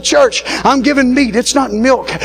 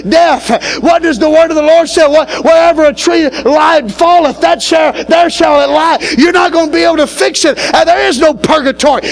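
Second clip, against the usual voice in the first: full-force preaching; 4 semitones higher than usual. Acted. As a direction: hammer the truth home to the congregation. Shouted preaching on judgment.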